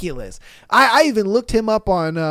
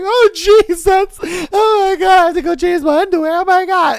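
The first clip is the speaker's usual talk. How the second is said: high, loud hammed-up gushing fangirl; swooning fan; 11 semitones higher, 5 dB louder than usual. Host mocking swooning commenters, high loud hammed-up gush. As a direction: squeal like a fan losing it. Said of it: "every Caroline ... just like".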